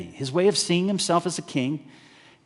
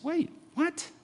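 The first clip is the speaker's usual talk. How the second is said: a high, soft, startled double take; mock disbelief; 13 semitones higher, 6 dB quieter than usual. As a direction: react as if you misheard. Listener baffled by loving enemies.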